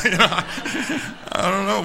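slow, laughing through the words; can't stop laughing. Real, not acted. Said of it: "laughing at the reaction".

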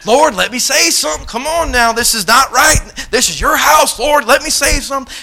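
high, worked-up begging; exasperated mock prayer; frustrated pleading. Acted frustrated plea to God.

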